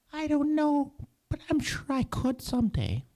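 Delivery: very high, squeaky little mouse voice; a tiny hopeful mouse piping up. Acted. Mouse answers lion.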